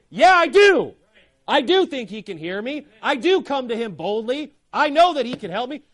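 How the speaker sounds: loud, high, emphatic declaration; defiant shout. Real, not acted. Preacher answering imagined scoffers, defiant shout. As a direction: shout it back with conviction.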